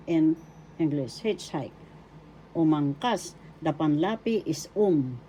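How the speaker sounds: normal talking voice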